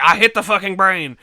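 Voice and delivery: retelling the boss's fed-up line; exasperated retort. Retold or imitated. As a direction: snap back in comic frustration. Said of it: "he's like".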